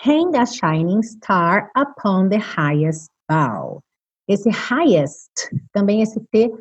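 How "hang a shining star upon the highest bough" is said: slow lyric reading. Shown as a demonstration. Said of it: "lyric read slowly".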